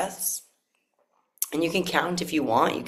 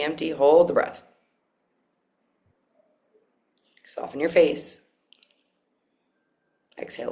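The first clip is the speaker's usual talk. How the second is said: guided breathwork. Acted. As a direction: coach each breath calmly.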